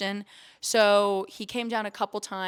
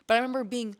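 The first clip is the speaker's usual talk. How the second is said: ordinary delivery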